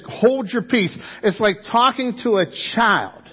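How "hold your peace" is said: commanding tone. Acted. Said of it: "Jesus stands up and he says".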